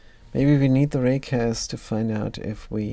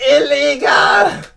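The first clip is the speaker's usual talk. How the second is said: a slow, much higher and louder cry of illegal; shouted accusation; 9 dB louder, slower than usual. Acted. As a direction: call it out at the politician. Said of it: "you're gonna shout".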